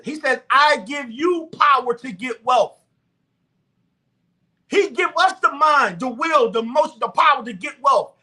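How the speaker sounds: high, loud preacher's exhortation; preaching at full force. Real, not acted. Preaching to viewers about using God's gift of wealth, high and loud. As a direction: preach it out hard to the congregation.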